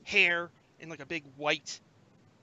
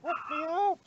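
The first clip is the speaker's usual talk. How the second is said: muffled voice; 14 semitones higher, 5 dB louder than usual. Acted. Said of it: "You hear a muffled voice".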